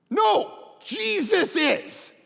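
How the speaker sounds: sharp shouted no. Real, not acted. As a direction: bark a loud, high no. Preacher cuts off objection.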